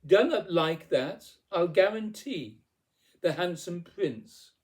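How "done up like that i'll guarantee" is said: loud, raised-pitch list of demands; spoilt, emphatic clamouring; demanding tantrum. A narrator's reading. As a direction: insist on every item, petulant and forceful. Cindy's shouted tantrum of demands continues.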